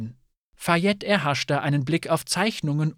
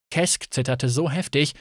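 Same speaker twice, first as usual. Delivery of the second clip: ordinary delivery, nothing marked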